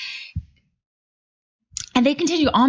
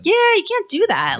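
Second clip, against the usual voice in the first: scolding; 12 semitones higher than usual. Retold or imitated. Telling off the rude customers.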